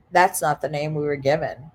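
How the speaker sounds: officer handed the licence, low, quick and matter-of-fact. Retold or imitated. Officer tells guest the name is wrong, low and official. Officer's line acted out in retelling.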